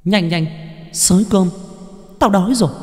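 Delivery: high, rising ghost demanding food; an impatient spirit calling from nowhere; hungry ghost voice. A narrator's reading. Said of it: "cái giọng nói ở đâu đó lại vang lên".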